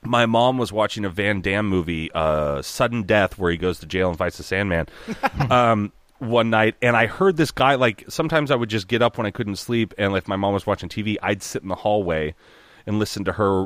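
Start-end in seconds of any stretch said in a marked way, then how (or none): none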